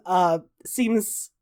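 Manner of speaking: normal talking voice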